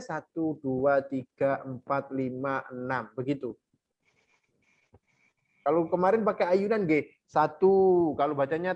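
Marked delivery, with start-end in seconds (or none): 0.0-3.5 slow, softer drawn-out count. Shown as a demonstration. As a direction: count each beat slowly and quietly. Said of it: "Kalau bacanya itu pelan".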